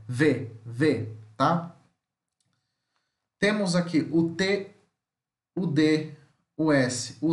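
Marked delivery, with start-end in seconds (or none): 0.1-1.1 voiced v with a little buzz; voiced V sound. Shown as a demonstration. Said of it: "só que ele é mais sonoro".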